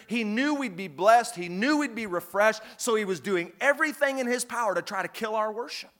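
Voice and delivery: ordinary talk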